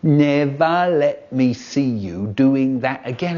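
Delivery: slow, stern imitation of Dad; slow mock-stern dad warning; brother mimicking Dad. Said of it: "a funny voice going".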